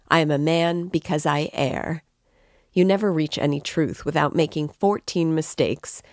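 usual speaking voice

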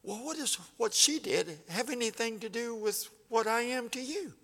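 rising in disbelief, an astonished wife pushing back; incredulous wife. Retold or imitated. Wife answers imagined husband.

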